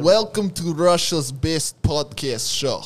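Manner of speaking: loud mock Russian host; Russian accent. Host opening Russia's best podcast, Russian accent. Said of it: "the Russian man with the accent".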